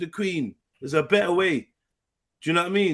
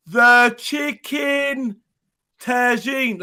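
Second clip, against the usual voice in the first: very slow, loud, higher, every syllable stretched out; over-slow tourist repeat; 7 semitones higher, 6 dB louder, much slower than usual. Retold or imitated. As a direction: spell out the chicken tagine to a confused waiter. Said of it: "I'm like, and then go".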